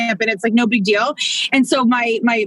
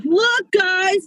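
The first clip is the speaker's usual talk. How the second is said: higher-pitched proud kid imitation; proud little boy; 6 semitones higher than usual. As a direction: show it off like a boy. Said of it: "he was so proud".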